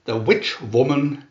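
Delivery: plain reading voice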